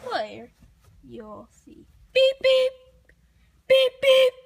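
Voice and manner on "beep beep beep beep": high beep beep like a horn; car horn imitation. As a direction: honk like a toy car.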